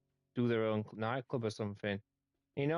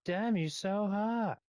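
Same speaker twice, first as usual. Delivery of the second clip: admiring; 7 semitones higher, slower than usual. Real, not acted. Admiring his new stage setup.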